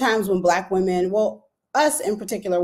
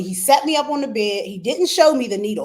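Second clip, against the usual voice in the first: ordinary talk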